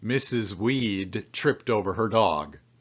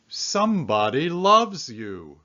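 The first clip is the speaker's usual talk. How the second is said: card words said louder, higher; card read aloud; 5 semitones higher, 5 dB louder than usual. Acted. Man reading his valentine card, touched and louder.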